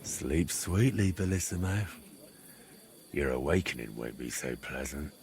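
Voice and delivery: sinister low voice. Acted. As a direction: gloat softly over the sleeper. Sinister whisper over sleeper.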